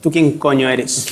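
slow, low mock-suspicious mother; a hint of a wary mum, slowly; lightly voiced mother. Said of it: "mi madre diga". (un toque de madre desconfiada, lenta)

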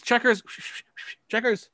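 high, coaxing pet call; calling a cat. Acted. Owner calling for Checkers the cat, high-pitched.

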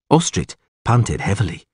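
ordinary delivery

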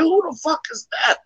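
high-pitched baffled question; shocked. Retold or imitated. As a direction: retell it in high disbelief. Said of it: "off guard and shocked".

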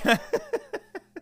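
very high, staccato laughter; helpless laugh. Real, not acted. Podcaster laughing at his own meltdown, high helpless laugh. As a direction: break into high giggles at your own outburst.

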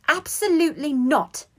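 high, loud refusal; loud, high-pitched protest; indignant cry. A narrator's reading. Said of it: "cried Hermione".

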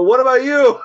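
loud, high-pitched comeback; startled retort. Retold or imitated. Group turning on the hunter, loud high retort. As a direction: throw the question back loudly. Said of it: "then they go".

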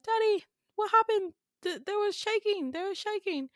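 high, anxious British-tinged voice; British accent. Acted. Kid telling dad about the shaking, high British accent. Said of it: "in a British accent".

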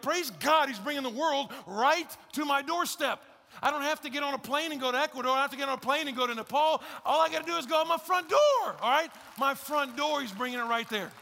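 high, loud, exultant preaching; excited praise. Retold or imitated. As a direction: proclaim it like thrilling news. Acting out an excited missionary.

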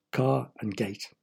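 ordinary delivery

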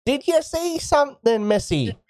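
high, lightly voiced old lady calling; old woman calling out. Old woman calls after the player, lightly voiced and high. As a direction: call out like a curious elderly woman. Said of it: "You hear one of them call out".

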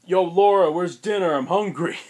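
loud, high, demanding bellow; a brute shouting for dinner as he comes home; mean boyfriend. Retold or imitated. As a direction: yell for dinner like a rude brute. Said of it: "Just a mean asshole".

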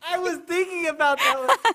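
high, loud delighted laugh; excited laughing. Real, not acted. Excited about the movie pick.

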